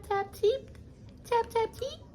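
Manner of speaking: dainty knock. Shown as a demonstration. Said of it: "I don't do no tap".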